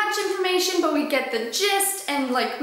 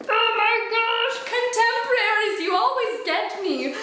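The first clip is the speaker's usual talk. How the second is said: exasperated gush; 7 semitones higher than usual. Real, not acted. Exasperated at genre.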